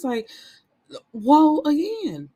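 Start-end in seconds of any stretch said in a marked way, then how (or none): 1.1-2.3 mock defensive exclaim. Boyfriend defensive retort.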